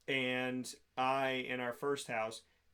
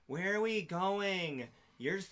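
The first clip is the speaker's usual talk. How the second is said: put-on slow drawn-out drunken whine, pitched up; drunk ex-wife; 5 semitones higher, slower than usual. Drunk wife in the back seat to husband, slurred whine. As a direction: imitate a drunk asking, stretching every word. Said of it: "this is how she talks".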